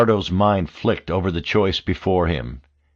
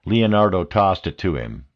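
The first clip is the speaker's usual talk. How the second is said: ordinary delivery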